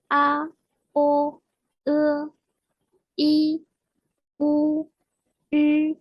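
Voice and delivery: vowels "A, O, E, I, O, U" each held level; sustained, evenly spaced model vowels; simple finals drill. Shown as a demonstration. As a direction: recite each vowel separately on a steady pitch. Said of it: "les finales simples".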